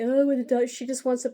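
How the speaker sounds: high, loud mock wail; hammed-up fake tears; mock crying. Mocking relatives crying on the phone for the diamond, hammed-up wail. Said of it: "crying, acting like".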